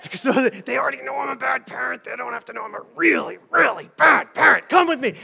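high, strained angry mom voice; yelling with her face. Acted. Mom calling herself bad parent, suppressed angry yelling. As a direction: scold in a tight, suppressed yell. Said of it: "she's yelling at you with her face".

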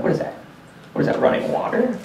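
hushed, puzzled inner voice; acted half-awake murmur; voice in head. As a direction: wonder softly to yourself. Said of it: "voice in your head".

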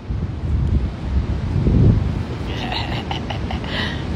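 a long, soft laugh at himself; breathy laugh. Real, not acted. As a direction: let it spill out quietly.

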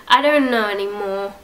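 usual speaking voice

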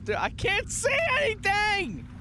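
high, exasperated panicky cry; high-pitched alarmed complaint; panicked whine. Real, not acted. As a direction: voice leaps up in panic. Panics in dark horror game.

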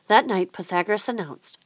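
plain reading voice

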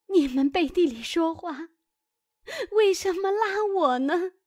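wronged maid crying out at her masters; tearful plea. Acted. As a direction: plead through tears, rising at the end. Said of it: "哭道".

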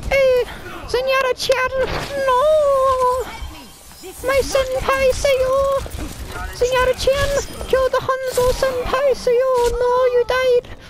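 Mercy voice. Pretend. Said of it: "and the Mercy voice".

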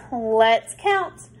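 usual speaking voice

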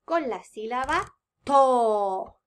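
slow, the final to stressed; stressing the syllable. Shown as a demonstration. Teacher naming the target syllable, slow and stressed. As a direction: lean on the last syllable. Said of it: "teacher stresses target syllable".

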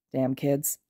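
plain reading voice

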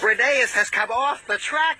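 cried. A narrator's reading. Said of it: "Skarloey cried".

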